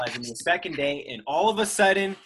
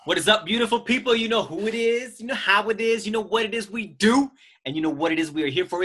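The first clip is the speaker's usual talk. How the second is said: hyped intro; 6 semitones higher, faster than usual. Acted. Host kicking off his podcast with a hyped, high intro. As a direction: pump up the listeners like a hype host.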